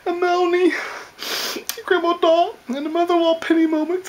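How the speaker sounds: high-pitched, hammed-up tearful pleading; mock crying. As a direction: beg forgiveness through exaggerated tears. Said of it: "I'm just crying".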